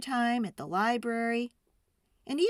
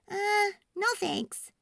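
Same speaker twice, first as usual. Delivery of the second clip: much higher and slow; little girl voice; 12 semitones higher, slower than usual. A narrator's reading. Girl refusing toys from father, high and slow. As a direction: politely refuse. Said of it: "she said".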